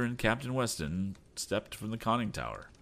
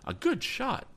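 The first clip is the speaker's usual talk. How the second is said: much higher put-on captain voice; gruff captain. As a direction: praise it as the old captain. Captain praises shot.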